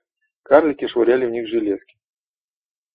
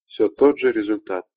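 ordinary delivery, nothing marked

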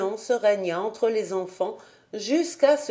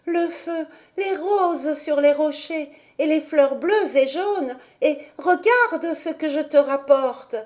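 put-on higher, eager little girl; excited child; 7 semitones higher than usual. A narrator's reading. Heidi tells grandfather about the sunset, eager and higher. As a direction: tumble out the wonders. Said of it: "s'écria-t-elle".

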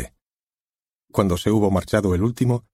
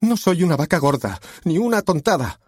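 tearful exclamation; 10 semitones higher than usual. Acted. The insulted actress protests to Harvey, high and tearful. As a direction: protest on the edge of tears. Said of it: "exclamó la actriz, rompiendo a llorar".

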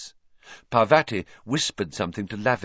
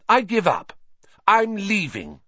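high, louder angry declaration; storming off; 13 semitones higher, 5 dB louder than usual. A narrator's reading. Hermione leaving divination for good, loud and angry. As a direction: announce it as you storm out.

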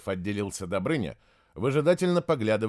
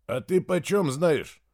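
distrustful; 7 semitones higher, 4 dB louder, slower than usual. A narrator's reading. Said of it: "недоверчиво спросил Святослав".

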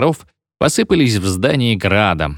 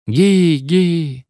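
booming echo; 7 semitones higher than usual. A narrator's reading. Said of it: "загремело эхо в здании".

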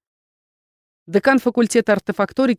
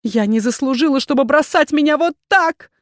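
higher cry building louder; tearful shout; 7 semitones higher, 4 dB louder than usual. A narrator's reading. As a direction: shout it as if through tears. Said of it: "закричала она сквозь слёзы".

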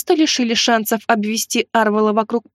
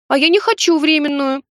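capricious and pouty, a bit higher; whiny spoiled pouty drawl; 5 semitones higher than usual. Acted. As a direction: whine like a spoiled bride. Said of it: "закапризничала я".

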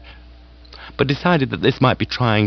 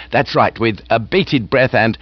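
hearty aunt voice; 12 semitones higher than usual. Acted. Aunt gushing to Bertie about lunch. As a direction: raise the pitch and relish the food.

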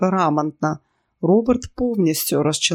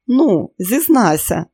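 raised, louder, drawn-out coaxing; insistent; 5 semitones higher, 5 dB louder, slower than usual. A narrator's reading. Pyera pressing Robert to confess, insistent. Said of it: "наполягала П'єра".

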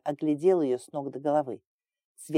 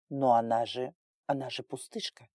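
low, hushed disbelief; stunned; 4 semitones lower, 4 dB quieter than usual. A narrator's reading. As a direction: falter softly, as if dumbfounded. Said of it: "Колдун был ошарашен". (ошарашенно, низко и тихо)

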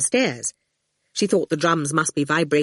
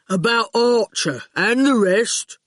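enticing goon; 4 semitones higher than usual. A narrator's reading. The goon tempting Howard with secrets, slow and coaxing. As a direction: lure the boy in slowly. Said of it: "he said enticingly".

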